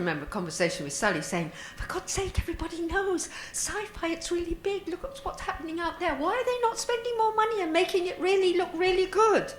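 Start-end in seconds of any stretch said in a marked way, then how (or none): 1.8-9.5 high-pitched, incredulous grumbling; retold exasperated plea. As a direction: raise the voice high, as if re-enacting a complaint. Retold exasperated complaint with a colleague.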